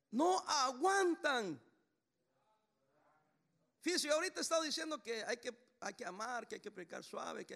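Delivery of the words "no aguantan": slow, drawn-out and much louder, stressing No; emphatic. Real, not acted. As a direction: stretch out No aguantan, louder. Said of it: "la gente ahora no aguanta nada". (No aguantan, lento y muy enfático)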